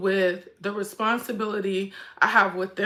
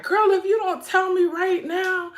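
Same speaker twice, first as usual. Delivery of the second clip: impatient friend; 10 semitones higher than usual. Retold or imitated. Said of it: "she was like".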